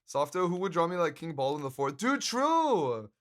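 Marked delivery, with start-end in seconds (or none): 2.0-3.1 higher, bursting out "true!"; suddenly excited agreement; dude, true, called out high; excited realisation. Real, not acted.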